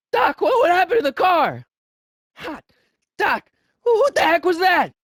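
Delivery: shocked alarm. Acted. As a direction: blurt it out in disbelief. Shocked at vanished car.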